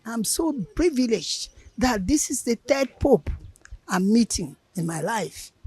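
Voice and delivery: ordinary talk, nothing marked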